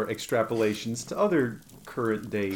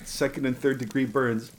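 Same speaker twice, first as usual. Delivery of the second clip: ordinary talk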